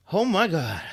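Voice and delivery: plain reading voice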